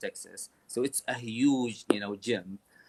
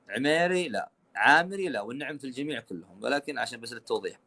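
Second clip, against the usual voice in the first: ordinary talk, nothing marked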